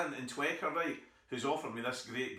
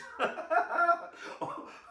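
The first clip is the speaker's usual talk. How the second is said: high cackling laugh at his own joke; laughing at the punchline of his own story; high burst of laughter; 4 dB louder than usual. Real, not acted.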